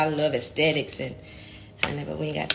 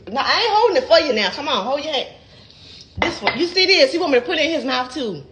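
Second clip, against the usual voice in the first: excited; 9 semitones higher, 6 dB louder than usual. Real, not acted. Calling husband over, teasing.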